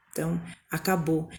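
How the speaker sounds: normal talking voice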